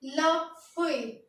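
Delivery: लफुई drawn out very slowly; slow French pronunciation. Shown as a demonstration. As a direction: say लफुई slowly for students to copy. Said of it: "उसके बाद आता है हमारा".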